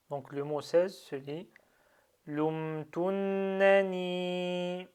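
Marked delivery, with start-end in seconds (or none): 2.3-4.9 "lumtunnani" very slow, held double n and long final i; shadda and prolongation. Shown as a demonstration. Teacher demonstrates noun with shadda, "lumtunnani" drawn out. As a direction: hold the nn of "lumtunnani", then stretch the last i. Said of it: "on fait le prolongement".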